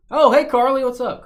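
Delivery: high, loud hello; loud greeting. Real, not acted. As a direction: call out a cheery hello.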